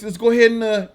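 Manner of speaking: ordinary talk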